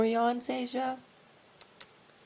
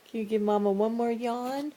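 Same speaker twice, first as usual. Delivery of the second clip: normal talking voice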